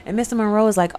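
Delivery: ordinary talk, nothing marked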